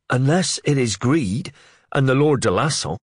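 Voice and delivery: put-on a keen young man chipping in; Wakelin reminding. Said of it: "Wakelin reminded them".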